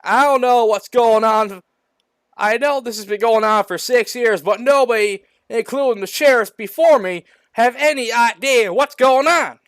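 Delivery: high, loud sheriff's rant; hammed-up exasperated lawman. A narrator's reading. Sheriff defends himself in acted quarrel.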